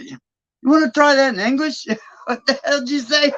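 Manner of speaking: high-pitched mock bafflement, breaking into laughter; retold incredulous question. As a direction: ask it in amused disbelief. Retells baffled reply to thick accent.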